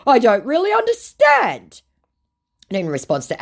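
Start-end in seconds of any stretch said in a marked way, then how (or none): 0.1-1.8 mock scammer voice. Woman reading the scammer's message aloud, in a high mock voice. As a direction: ham up the creepy flattery.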